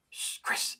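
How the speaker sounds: hushed ghostly whisper; whispered name. Retold or imitated. Unseen voice calls him through headphones, whispered. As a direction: whisper as if inside someone's headphones.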